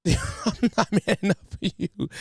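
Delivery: ordinary delivery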